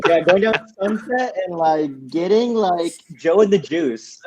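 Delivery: lightly mocked LA girl accent; LA small-talk voice, drawn out at the end. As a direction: do a valley girl from LA.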